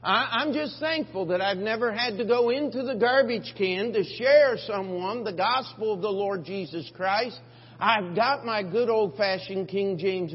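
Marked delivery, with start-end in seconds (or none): none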